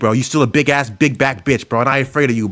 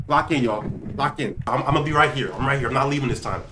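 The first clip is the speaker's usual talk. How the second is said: hushed focus; 7 dB quieter than usual. Real, not acted. Player telling viewers to lock in, hushed. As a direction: keep it small and soft, bracing yourself. Player braces quietly, tense waiting.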